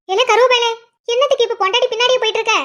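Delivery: high, rapid needling question; taunting. Acted. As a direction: needle him for trailing after his wife. Relative taunts husband trailing his wife. (உயர்ந்த குரலில் வேகமான கிண்டல் கேள்வி)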